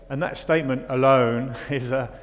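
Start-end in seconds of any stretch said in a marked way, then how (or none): none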